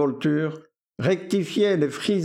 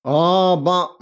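loud, offhand "ah ben" in a different voice; offhand valet; 2 semitones higher, 6 dB louder than usual. A narrator's reading. Victor shrugging off the girl, offhand. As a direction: brush it off like a bored dandy.

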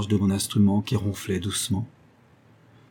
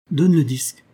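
higher, louder command; tense, raised-voice demand; tense order; 6 semitones higher, 5 dB louder than usual. A narrator's reading. Tense order to play the record.

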